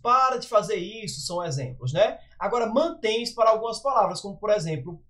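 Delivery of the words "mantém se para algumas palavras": ordinary talk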